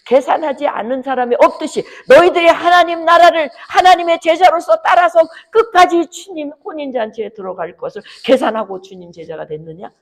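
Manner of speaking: high, loud and urgent pulpit cry; fiery preaching. Acted. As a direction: drive the challenge home to the congregation.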